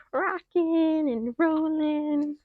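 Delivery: high, drawn-out playful lilt. Acted. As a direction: sing it out like a game. Playful rocking and rolling cue.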